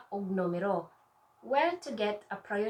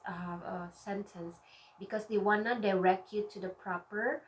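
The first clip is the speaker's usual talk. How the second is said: usual speaking voice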